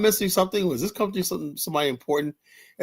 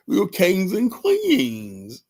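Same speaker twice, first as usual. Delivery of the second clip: mock royal oration; 7 semitones higher, much slower than usual. Mock-grand speech about African royalty.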